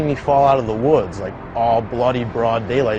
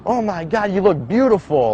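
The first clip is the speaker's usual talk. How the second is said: amazed nurse; 6 semitones higher than usual. Retold or imitated.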